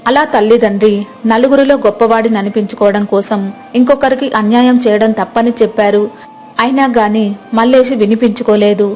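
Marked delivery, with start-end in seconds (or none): none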